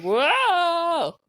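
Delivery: high, drawn-out mock whoa; teasing, stretched-out copy of amazement; imitated loud whoa. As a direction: mimic a friend's big exclamation. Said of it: "You're like".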